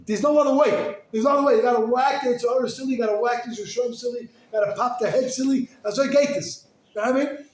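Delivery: high, loud fervor; preacher urging the crowd to fight, loud and high; fervent preaching. Real, not acted. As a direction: drive the point home hard.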